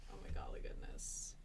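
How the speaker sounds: unvoiced low whisper; whispered exclamation. Real, not acted. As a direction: breathe it out exasperated. Frustrated at slow loading.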